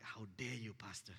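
hushed indignant whisper. Retold or imitated. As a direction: whisper it like a secret thought. Imagined thoughts of the couple.

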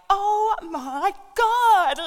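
high, loud and drawn out in horror; the horrified young wife, slowly; shocked gasp. Acted. Wife watching her husband shoot the horse, horrified high cry. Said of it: "the wife is like".

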